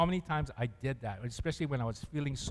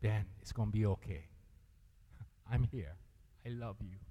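much softer, low tender reassurance; gentle comforting voice; 7 semitones lower, 9 dB quieter than usual. Retold or imitated. Jesus comforting Ben when he felt down, soft and tender. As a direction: hush it like a comforting embrace. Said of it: "he let me know".

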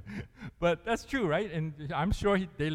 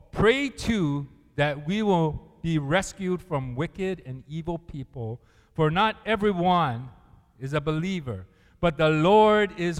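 slow, louder scripture reading; reading scripture aloud together; 5 dB louder than usual. Shown as a demonstration. Preacher leading the church in reading scripture, slow and loud. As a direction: lead the congregation through the verse. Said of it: "Read it with me".

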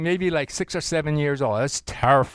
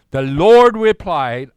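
group reading aloud; 11 dB louder, slower than usual. Shown as a demonstration. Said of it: "I want you to read it with me".

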